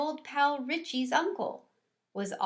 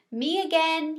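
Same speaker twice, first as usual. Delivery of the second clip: louder, cheery greeting; cheerful custodian; 7 semitones higher, 6 dB louder than usual. A narrator's reading. Custodian greeting the classroom pets, cheery and loud. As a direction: announce yourself at the door.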